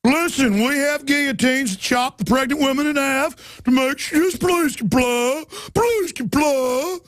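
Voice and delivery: high, slow, syrupy officer; mock officer pleading. Host mocking officers urging compliance, high and slow. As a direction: coax them to comply. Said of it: "I don't wanna give them ideas".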